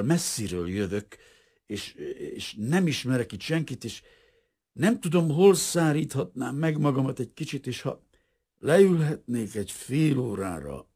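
high, halting, pleading stammer; stammering, teeth chattering. A narrator's reading. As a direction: beg shelter haltingly, chilled through. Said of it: "hebegte vacogó szájjal". (hebegve, vacogó szájjal)

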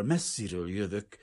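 hesitant, cold-shaken request; chattering stammer. A narrator's reading. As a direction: stammer it out as if shivering. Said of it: "hebegte vacogó szájjal".

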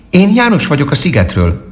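high-pitched little squirrel character; friendly squirrel. A narrator's reading. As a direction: speak up high as a cheerful squirrel.

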